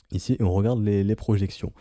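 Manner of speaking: normal talking voice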